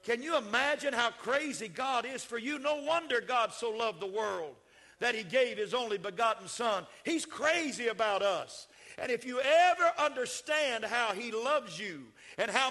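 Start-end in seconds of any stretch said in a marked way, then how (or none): none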